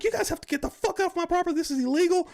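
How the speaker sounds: very high-pitched mock outrage; imitated freak-out. Russ ordering trolls off his property, high-pitched mock freak-out. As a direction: imitate a panicky, indignant man ordering people out. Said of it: "he's freaking out".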